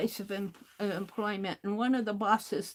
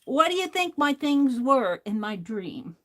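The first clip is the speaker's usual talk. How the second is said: usual speaking voice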